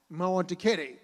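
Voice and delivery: put-on loud, insistent little-kid voice; a small boy demanding a kitty; lightly voiced stubborn toddler.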